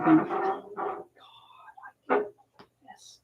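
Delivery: whispered dread, retold; dismayed inner whisper. Storyteller thinking to herself on the patio, dismayed whisper. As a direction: whisper it as a private thought.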